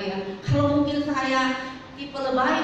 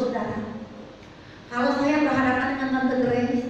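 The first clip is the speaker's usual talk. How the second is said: ordinary delivery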